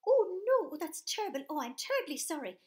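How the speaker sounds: high, loud shocked gasp of words; shocked mum. Acted. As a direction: sound aghast, then apologetic. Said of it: "the mum was really shocked".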